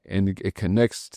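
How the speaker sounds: ordinary talk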